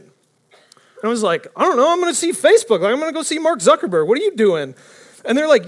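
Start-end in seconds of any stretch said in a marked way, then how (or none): 1.6-4.7 higher-pitched, breezy protest; retold cocky comeback, pitched up; brash mock reply. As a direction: toss it off like you belong there. Acted cocky reply to guards.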